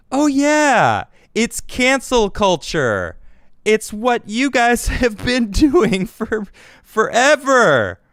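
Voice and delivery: high, loud sarcastic "oh yeah", slow, laughing through it; sarcastic mock realization. Real, not acted. Vlogger mocking critics about cancel culture, sarcastic and loud. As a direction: act like the answer just hit you, mockingly.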